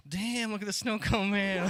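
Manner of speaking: high, drawn-out swooning catcall; mock admiring lady. Imagined lady ogling snow cone man.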